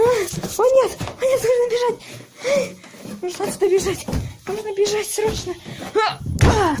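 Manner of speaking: high panicked cries while fleeing; panic, need to run now. Real, not acted. Fleeing the monster in game.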